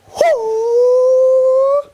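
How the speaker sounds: high sharp hoo cry; a loud high karate yell; sharp high-pitched hoo. Shown as a demonstration. Karate teacher's crane jump cry.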